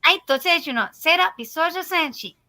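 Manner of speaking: a hint of high anime girl; light anime-girl Japanese line; cute anime streamer. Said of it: "he wants to hear me do my anime voice".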